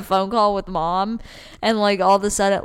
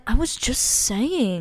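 mocking sister voice; 3 semitones higher, 4 dB quieter, slower than usual. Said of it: "she'll be like".

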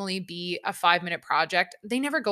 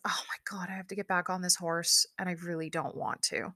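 softer whispered inner voice; anxious whisper; 2 semitones lower, 8 dB quieter than usual. Retold or imitated. Thinking about getting back on the horse, anxious whisper. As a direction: whisper the dread to yourself. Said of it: "all I can think is".

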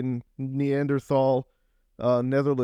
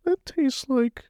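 very high, slow, breathless aside; mock the comic's whiny inner voice, drawn out and high; high slow comic's-inner-voice whine; Jim Gaffigan impression; much slower than usual. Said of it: "Jim Gaffigan's, like, his, like, shitty voice".

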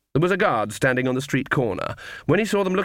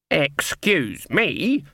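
slow, drawn-out cold menace; every word loaded like a threat; menacing excuse me; 4 semitones higher, much slower than usual. Acted. Granny warning the captain at the gate, slow and menacing. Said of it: "words like charge and kill".

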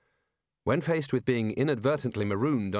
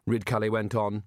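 usual speaking voice

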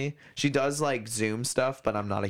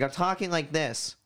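higher voice pushed up into the nose; nasal placement; 6 semitones higher than usual. Shown as a demonstration. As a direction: talk from up on your nose. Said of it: "speaking from up here on my nose".

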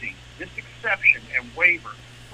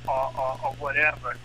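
ordinary talk, nothing marked

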